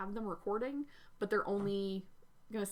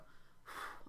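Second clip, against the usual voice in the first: long breathy exhale, out of breath; tired sigh; 12 dB quieter than usual. Real, not acted. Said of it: "I'm out of breath".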